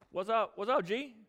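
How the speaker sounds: high, breezy mock greeting; flippant. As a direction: toss it off like an over-familiar pal. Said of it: "with the same kind of flippancy that girl does".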